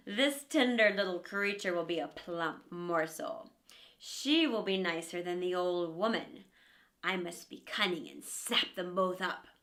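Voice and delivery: lightly voiced hungry wolf; a touch of a greedy wolf. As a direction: plot the meal under your breath. Wolf's greedy inner thoughts.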